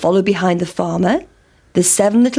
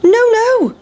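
very high pancake protest; high, playful storybook squeal; crying out; 14 semitones higher, 4 dB louder than usual. A narrator's reading. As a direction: cry out high in refusal. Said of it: "cried the big pancake".

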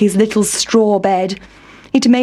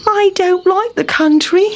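higher, mildly sighing complaint; a small weary moan; sighing Town Mouse; 10 semitones higher than usual. Acted. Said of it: "sighed Town Mouse".

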